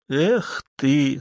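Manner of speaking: small sinking sigh of disappointment; understated, dejected reproach; crestfallen. A narrator's reading. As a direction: let the voice drop in mild dismay. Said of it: "сказала упавшим голосом мать".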